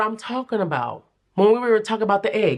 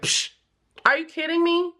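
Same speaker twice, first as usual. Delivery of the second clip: high, disbelieving quip; incredulous; 10 semitones higher than usual. Retold or imitated. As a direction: act shocked and dismissive. Spirit incredulous.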